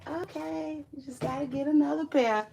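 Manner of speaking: high, quick retold reassurance; mild calm boyfriend. Imitating her boyfriend calmly reassuring her, lightly voiced. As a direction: voice her man being cool. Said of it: "My baby said".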